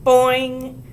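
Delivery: loud high boing; yelled boing. A narrator's reading. Ramona tweaking Susan's curl, yelling loudly. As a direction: shout the boing with glee.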